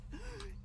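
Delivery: sudden frightened gasp; sharp gasp. A narrator's reading. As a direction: gasp in shock. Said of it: "she gasps".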